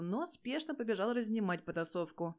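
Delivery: plain reading voice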